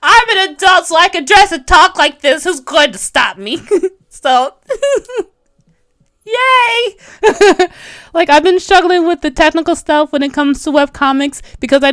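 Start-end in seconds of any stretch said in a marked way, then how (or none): 4.1-7.7 laughing cheer. Real, not acted. Cheering after her own laugh.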